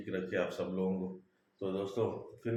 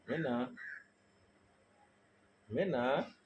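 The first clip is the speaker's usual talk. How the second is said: high, soft sing-song calls to the bird; coaxing a pet bird; 4 semitones higher, 5 dB quieter, slower than usual. Real, not acted. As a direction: gently coax the myna to answer. (प्यार से मैना को पुकारते हुए ऊँची, धीमी आवाज़)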